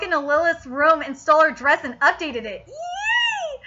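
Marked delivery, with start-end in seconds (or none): 2.7-3.6 very high, thin "yee"; tiny high-pitched cheer; delighted squeal. Real, not acted. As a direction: squeal with glee, high and drawn out. Delighted reaction to the story.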